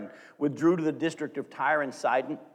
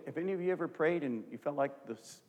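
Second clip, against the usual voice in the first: ordinary delivery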